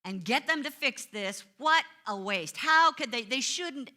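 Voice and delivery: fed-up driver grumbling about a bad oil change, loud and quick; griping complaint. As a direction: rattle off blame at the oil change shop. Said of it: "So my shoulds came out".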